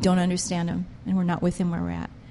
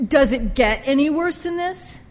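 pained lament; 7 semitones higher than usual. Retold or imitated. Jesus grieving over offended followers.

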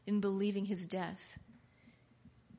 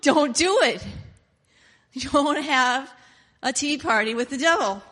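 higher, much louder urgent warning; 4 semitones higher, 10 dB louder than usual. Real, not acted. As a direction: shout it to stop them. Preacher warns loudly.

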